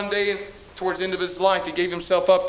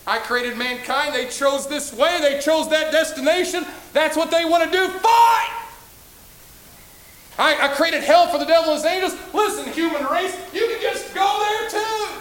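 exasperated God; 7 semitones higher, 4 dB louder than usual. Acted. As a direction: throw up your hands as a fed-up God. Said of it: "God right there could have said".

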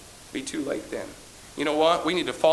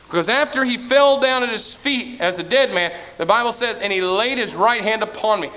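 fervent preaching; 6 semitones higher, 5 dB louder than usual. Acted. Preaching at force.

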